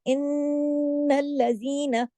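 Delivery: इन्नल्लज़ीना with a long hummed न्न; slow recitation, hold the न्न in इन्नल्लज़ीना; full nasal hold. Shown as a demonstration. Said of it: "पढ़ा गुन्ना होगा". (इन्नल्लज़ीना, बड़े गुन्ने के साथ)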